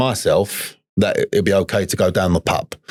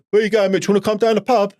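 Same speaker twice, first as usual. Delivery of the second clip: put-on high, breezy voice of an old mate; old drinking mate; 11 semitones higher than usual. An old drug buddy invites Mitch to the pub, lightly voiced cheery mate. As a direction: toss it off like a pub pal inviting him along. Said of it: "and he's like".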